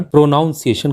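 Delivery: प्रोनाउंसिएशन with "noun" in the middle; common mispronunciation. Shown as a demonstration. Teacher showing learners' habit, mispronounced with "noun". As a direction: say प्रोनाउंसिएशन as pro-noun-see-ay-shun. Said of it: "हम इसका गलत उच्चारण".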